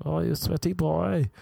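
high, put-on teasing voice for the girl; teasing girl's voice. Girl teasing the sweaty comedian, high mock voice.

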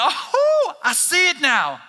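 high, loud delighted gasp of discovery; sudden aha, thrilled; acting out the moment it clicks; excited realization. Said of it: "Revelation's that moment, it's like".

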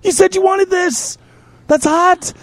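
high, rushed pleading; frantic pleading. Retold or imitated. Man retelling his frantic pleas to screaming girlfriend. Frantic explanation during night terror.

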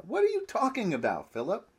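exasperated disbelief. Acted. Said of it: "trying to show the mood of his questioning".

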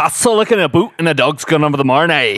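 quick, thick Scottish patter; Scottish accent. Pretend. Host showing off his one phrase, Scottish accent. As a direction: rattle off a stock Scottish saying. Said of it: "only phrase I can say in Scottish".

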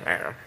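low, soft mock bear roar; understated bear "rawr"; imitated bear growl. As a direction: give a small, half-hearted bear rawr. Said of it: "the bear going".

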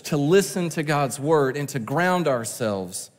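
ordinary delivery

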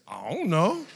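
put-on swooping, sing-song kid's answer; clueless child. Daughter answering mother about missing sister, clueless. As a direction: mimic a daughter with no idea.

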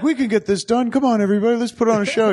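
put-on rally everyone like a kid putting on a show; can-do kid impression. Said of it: "Uh, just like".

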